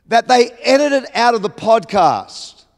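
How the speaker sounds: normal talking voice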